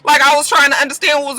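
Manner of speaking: worked-up, accusing rant; mad on his behalf. Real, not acted. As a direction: challenge him, pitch climbing to the end. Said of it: "I was mad for Daniel".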